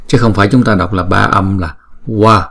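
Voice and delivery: normal talking voice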